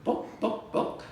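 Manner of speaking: clucky chicken voice saying book; chicken clucking. Acted. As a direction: cluck the words like a hen. Chicken in the library joke.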